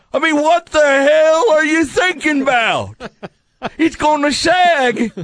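put-on high, slow, shouting old grump; curmudgeonly old man voice. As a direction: bark it like a cranky old man. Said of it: "curmudgeonly old man voice".

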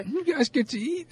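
high whiny mock of a co-host's complaint; mocking whiny imitation. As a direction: parrot the line back, high and whiny. Cohost mocks complaint right back.

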